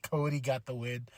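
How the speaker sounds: ordinary talk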